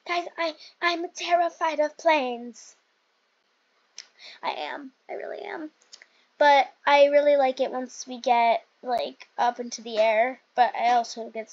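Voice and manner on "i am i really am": whispered confession. Real, not acted. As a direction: breathe it like a secret. Confessing fear of planes.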